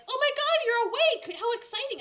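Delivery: high, loud, gushing puppy voice for the cat; high, loud squeal of a cat thrilled you're awake; excited dog voice. Pretend. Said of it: "Daisy was like".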